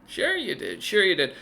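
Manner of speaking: high, swooping teasing voice; playful woman rogue. Acted. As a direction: lilt up high as a cheeky lady rogue.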